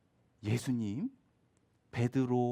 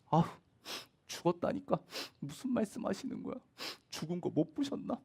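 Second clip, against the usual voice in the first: scoffing mourners; 4 semitones higher, 5 dB quieter than usual. Acted. Mourners mock Jesus.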